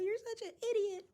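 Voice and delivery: very high, hammed-up girly wail; mock tearful scolding. Healer crying to the main character as she heals him, high mock wail. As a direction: whine it like a crying anime girl. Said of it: "Starts crying to the, uh, main character".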